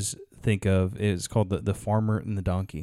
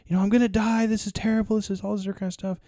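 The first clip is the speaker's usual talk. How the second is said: mock-panicked, much higher voice; hammed-up panic, pitched way up; panicking donkey; 9 semitones higher, much faster than usual. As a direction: squeal it like a frantic trapped animal. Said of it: "he started screaming and panicking".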